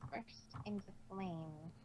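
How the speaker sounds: low, soft, slow mutter; slow embarrassed mumble. A narrator's reading. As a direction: mumble it down into your chest in embarrassment. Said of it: "muttered Trixie".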